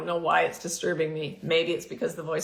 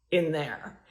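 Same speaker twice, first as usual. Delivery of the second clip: ordinary talk, nothing marked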